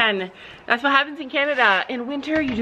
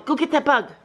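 normal talking voice

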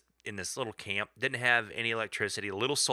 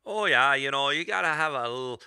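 ordinary talk

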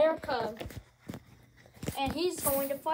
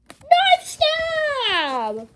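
excited call; 12 semitones higher, 8 dB louder than usual. Acted. Commentator calling the move.

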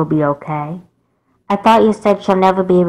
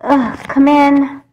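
slow, tearful and higher; crying; 8 semitones higher, slower than usual. Acted. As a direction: sob it out. Crying after the scare.

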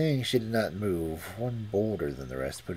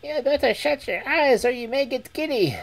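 very high bird voice; falcon voice; 5 dB louder than usual. Acted. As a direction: chirp it out like a little bird. Falcon warns cat.

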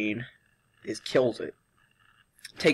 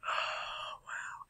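drained, barely a whisper; whispered wow; 17 dB quieter than usual. Real, not acted. Wiped out by sugar, sighing a whispered wow. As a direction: sigh into a breathless whispered wow. Exhausted after sugar challenge.

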